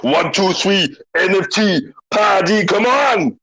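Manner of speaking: rally the crowd; hammed-up party shout; hype shout. Hyping an NFT party.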